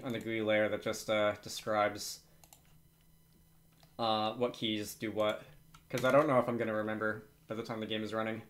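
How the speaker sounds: ordinary talk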